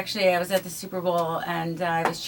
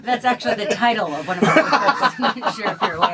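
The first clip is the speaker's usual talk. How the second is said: laughing; 3 semitones higher, 6 dB louder than usual. Real, not acted. Joking about movie title.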